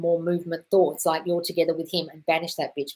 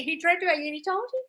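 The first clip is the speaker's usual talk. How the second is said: usual speaking voice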